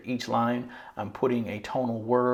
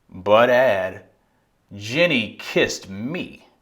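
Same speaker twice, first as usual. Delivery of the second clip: slow, emphatic poem ending; declarative, boastful; 8 dB louder, much slower than usual. Shown as a demonstration. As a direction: proudly declare it with energy, drawing out the words. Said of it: "is declarative and boastful".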